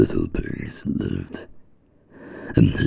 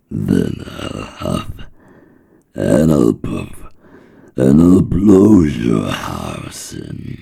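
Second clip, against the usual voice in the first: wolf threat building louder and higher; 4 semitones higher, 6 dB louder than usual. Acted. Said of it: "the wolf showed his teeth and said".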